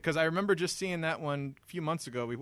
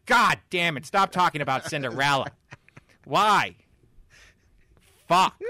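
loud, exasperated fake outburst; mock angry rant; 9 dB louder than usual. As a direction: rant like a fed-up cohost. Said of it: "he's gonna".

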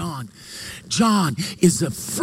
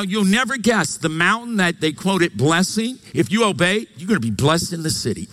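ordinary talk, nothing marked

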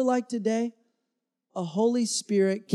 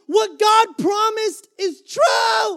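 excited cry; 11 semitones higher, 6 dB louder, slower than usual. Retold or imitated. Preacher voicing the rejoicing spies.

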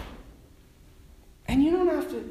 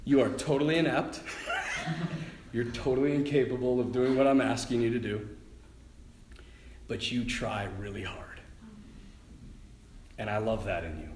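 tender voice of God; 8 semitones lower, 5 dB quieter than usual. Retold or imitated. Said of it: "he's like".

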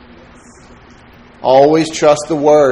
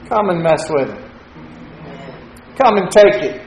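Texas defiance. Retold or imitated. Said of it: "we'd say in Texas".

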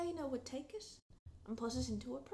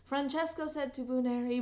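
ordinary delivery, nothing marked

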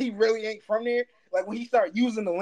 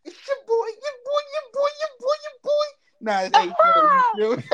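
much higher, louder at the end, singsong; mock hype intro; 4 dB louder than usual. Cohost introducing himself on the podcast, a high singsong mock hype chant. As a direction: ham up the show intro.

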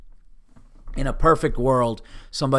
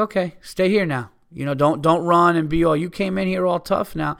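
higher, louder taunting dare; retold challenge; 5 semitones higher, 5 dB louder than usual. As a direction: call them back, acted out with force. Retold challenge to retreating women.

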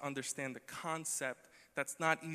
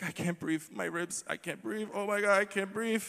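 mock breathless laughing; 5 semitones higher than usual. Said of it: "it's a physical thing".

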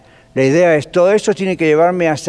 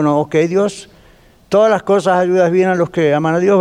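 normal talking voice

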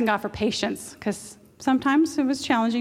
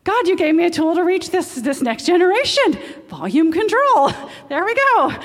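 higher, louder, laughing at the end; thrilled discovery; 7 semitones higher, 5 dB louder than usual. Retold or imitated. Preacher retelling her joy to God, high and laughing. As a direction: burst out in delighted thanks. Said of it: "I'm like".

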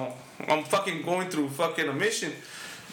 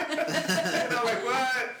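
drawn-out words through laughter, higher at the end; laughing disbelief; 4 semitones higher, much slower than usual. Retold or imitated. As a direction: retell your disbelief, cracking up. Laughs while retelling his disbelief.

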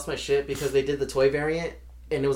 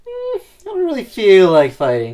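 reluctant; 7 semitones higher, 11 dB louder than usual. Acted. Reluctant reply to fight call.

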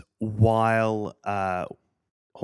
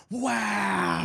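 excited wow; 11 semitones higher than usual. Retold or imitated. Retelling reaction to a long shot.

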